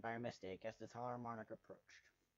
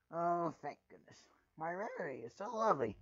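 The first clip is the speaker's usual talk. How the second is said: Rarity gushing over her trinket, higher; 5 semitones higher, 7 dB louder than usual. Acted. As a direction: gush like a drama-loving unicorn. Rarity voiced in the story.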